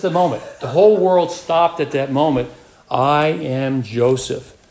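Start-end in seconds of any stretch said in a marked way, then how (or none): 2.9-4.6 very slow, each word weighed; slow dramatic reveal. Shown as a demonstration. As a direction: let the world stop on each word. The whole world stopped moment.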